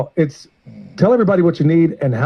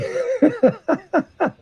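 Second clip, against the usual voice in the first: high, soft burst of laughter; real laugh; 4 dB quieter than usual. Real, not acted. Joking after brotherly "I love you.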